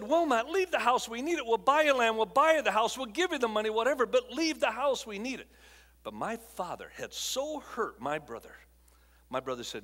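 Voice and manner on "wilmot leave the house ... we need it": higher, louder urgent begging; pleading. Retold or imitated. Parents begging their oldest son not to take the house, raised pleading voice. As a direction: plead with him, voice raised and insistent.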